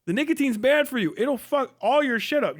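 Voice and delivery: ordinary talk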